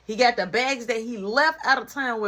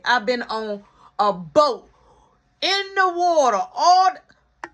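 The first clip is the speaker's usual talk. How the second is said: exasperated mom; 4 semitones higher, 6 dB louder than usual. Retold or imitated. Mom complaining about the cruise.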